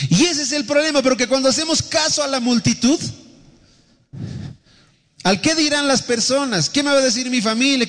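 very high, insistent sermon voice; impassioned preaching. Real, not acted. Pastor warning against heeding what people say, impassioned preaching. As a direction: pile up the anxious questions at a high preaching pitch.

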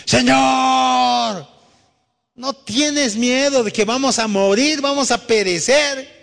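high, desperate shout of fear; terrified plea to the sleeping master; panicked cry. Acted. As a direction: cry out like sailors about to drown. Disciples panicking in the storm.

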